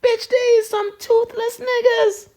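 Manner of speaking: high, slow, squealing laugh-cry; crying with laughter. Real, not acted. Showing followers the toothless cow, crying with laughter. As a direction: wheeze the words through tears of laughter. Laughing at cow's missing teeth.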